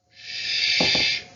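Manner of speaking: a hushed whispered shh; shushing whisper. A narrator's reading. Mouse shushing himself in a whisper. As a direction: hush yourself to stay hidden. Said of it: "said Morris to himself".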